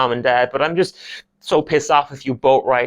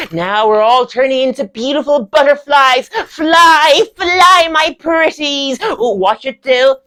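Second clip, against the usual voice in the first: high, loud, soaring drama lecturer; Stevie; 13 semitones higher, 7 dB louder, slower than usual. Acted. Lecturer leading butterfly exercise, grandly effusive. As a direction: urge them to take flight.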